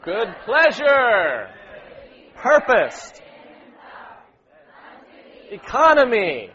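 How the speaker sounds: loud, drawn-out emphasized words; emphatic call-out. Real, not acted. Leader calling key words as sisters read, loud emphasis. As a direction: call each word out over the group's reading. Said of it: "emphasize the words".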